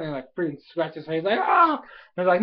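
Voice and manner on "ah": sudden high, loud "ah"; mock yelp of pain. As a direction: yelp as if scratched. Imitates cry of scratched character.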